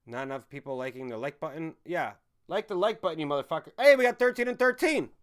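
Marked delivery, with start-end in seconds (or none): 3.8-5.1 loud, high-pitched announcement; excited shout. Real, not acted. Streamer announcing the like count to chat, excited shout.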